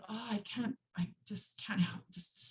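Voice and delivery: quiet, breathless half-whisper; wife whispering her frustration to husband; exasperated whisper. Retold or imitated. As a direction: sigh it out under your breath. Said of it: "I'm just like".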